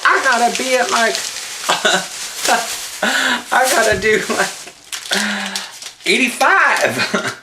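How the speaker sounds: high, laughing through the words; chuckling while he admits it. Real, not acted. Joking about speeding on highway.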